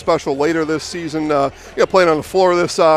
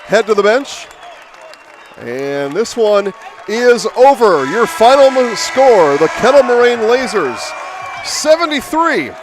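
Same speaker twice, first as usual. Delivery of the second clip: announcer read. Acted. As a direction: call the final score like a courtside announcer. Final score announcement on air.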